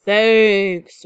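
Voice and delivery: loud, booming sea monster voice; big storybook monster's bellow. A narrator's reading. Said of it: "booms the monster".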